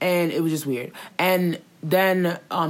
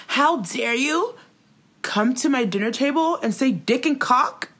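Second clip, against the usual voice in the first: hammed-up offended host, pitched up; mock outraged Tati; 8 semitones higher than usual. Friend retelling the video, quoting her outraged. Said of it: "she was like".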